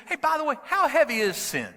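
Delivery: high, loud mocking heckle; cocky young man heckling the speaker; retold taunting shout. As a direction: shout the challenge up at the stage. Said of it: "he shouted out again".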